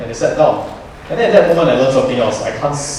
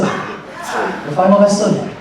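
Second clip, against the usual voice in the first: dramatic announcement; 8 semitones higher, much slower than usual. Acted. Dramatic announcement of final lesson.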